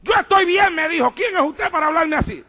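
high-pitched mock indignation; retold indignant retort. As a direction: imitate an affronted man pushing back. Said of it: "él me habló en voz alta". (imitando una réplica indignada, en tono agudo)

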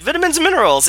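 put-on high, loud parroted British accent; hammed-up copycat British accent; mimicked British accent. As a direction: echo it in an overexcited fake British accent. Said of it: "Zeph would repeat it with Bear's accent".

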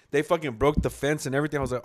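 ordinary talk

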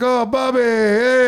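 high, loud, drawn-out cheer for a favorite regular; imitated excited shout of welcome; delighted greeting. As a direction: call it out like greeting an old friend. Donut staff acted greeting a regular.

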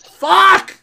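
high yelled curse; frustrated shout. Retold or imitated. Frustrated outburst after call.

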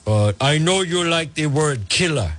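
lightly put-on thick Russian accent, quoting Lavrov; a hint of Lavrov's heavy Russian accent. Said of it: "in his thick Russian accent".

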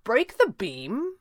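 slow, high question, rising; playing dumb. A narrator's reading. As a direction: echo it back as an innocent question. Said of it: "elected to play dumb".

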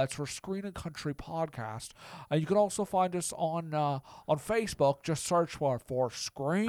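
stammering old movie star impression; Jimmy Stewart. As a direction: hem and haw like an old Hollywood actor.

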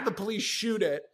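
normal talking voice

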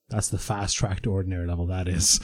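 quiet, low menacing teacher aside; low menacing warning. Retold or imitated. Teacher pulling a pupil aside, low and menacing. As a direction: drop the voice low and lean in with a threat. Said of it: "he go".

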